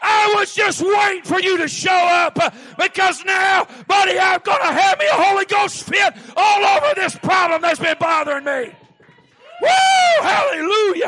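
loud, high, fervent revival preaching; hollering Southern preacher; preaching at full force. Acted. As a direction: whip the congregation up.

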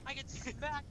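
loud, high, exasperated recap; worked-up complaint. Real, not acted. Player in first who got smacked back, exasperated.

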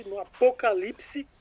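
dramatic emphasis. Acted. Dramatic stretched word.

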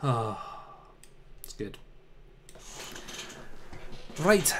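ordinary talk, nothing marked

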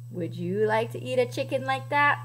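ordinary delivery

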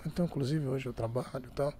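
hushed mock interview answer; imitating a soft-spoken coach. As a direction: mimic a shy coach speaking far too low. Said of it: "o técnico falava muito baixinho".

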